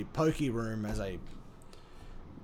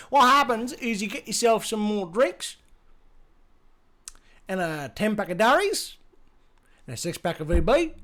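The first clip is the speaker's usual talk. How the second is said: hammed-up Australian bogan ramble, often higher; lazy Aussie slang; 3 semitones higher than usual. Shown as a demonstration. Streamer shows how lazy Australian talk gets, hammed-up broad Aussie ramble. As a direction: run the words together like a rambling Aussie bloke. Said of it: "you can just be as lazy as fuck as you want with the language".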